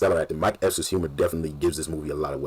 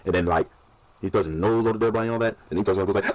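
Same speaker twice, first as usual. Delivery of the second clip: ordinary delivery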